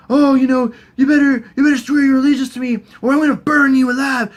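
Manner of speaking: high, loud, overbearing warning; mock threat. Dany threatening Varys over allegiance, high loud mock threat.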